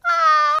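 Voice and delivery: high, loud fangirl squeal; excited squeal. Retold or imitated. Fan reacting to a scene she loved, excited squeal.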